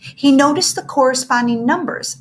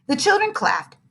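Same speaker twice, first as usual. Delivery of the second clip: ordinary delivery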